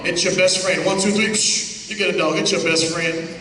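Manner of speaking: ordinary talk, nothing marked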